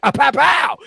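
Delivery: loud, high mock gunshots; shouted gun-noise hype. Host hyping up own show, playful shouted pows. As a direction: punch out the pows like a hype man.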